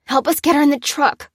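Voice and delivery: high, loud, strained call; effortful, urgent shout; grunting with effort. A narrator's reading. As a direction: call for help while hauling weight. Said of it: "grunted Lucy as she dragged".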